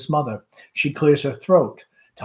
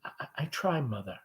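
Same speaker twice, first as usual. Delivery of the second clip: much softer, hesitant son; barely above a murmur, gentle; gently; 13 dB quieter, faster than usual. Acted. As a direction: son answering his mother, soft and gentle. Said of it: "Tom, gently".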